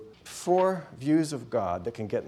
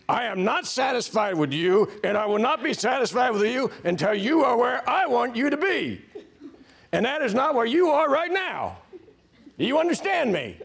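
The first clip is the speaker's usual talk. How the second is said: loud, high drill-instructor tirade; full-force hammed-up bellow at a recruit; angry demand; 11 semitones higher, 6 dB louder than usual. Taskmaster God unsatisfied with the recruit, angry shouted demand. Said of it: "this kind of an angry demand".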